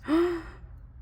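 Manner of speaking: sharp breath in of delight; delighted gasp. Real, not acted. Unboxing the kit, a delighted gasp.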